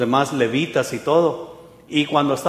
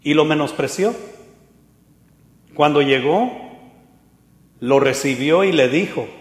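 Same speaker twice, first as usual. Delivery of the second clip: ordinary talk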